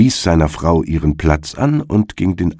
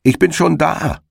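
high, ringing call across the field; 12 semitones higher than usual. Acted. Hedgehog calls to the arriving hare, high and ringing. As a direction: call it out smugly from the end of the furrow. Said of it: "rief ihm der Herr Igel entgegen".

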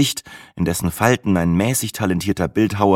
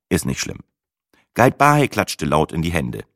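ordinary delivery, nothing marked

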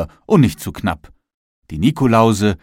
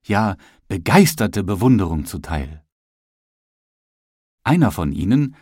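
ordinary delivery, nothing marked

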